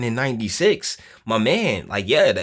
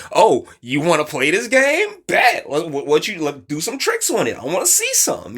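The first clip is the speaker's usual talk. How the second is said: higher, louder cocky dare; cocky challenge; 6 semitones higher, 4 dB louder than usual. Retold or imitated. Host acts a man answering aggression, loud cocky dare. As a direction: throw down the dare with swagger. Acting a confident man's challenge.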